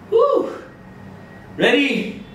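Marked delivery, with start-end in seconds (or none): 0.1-0.5 excited whoop. Real, not acted. Cheer after exercise set.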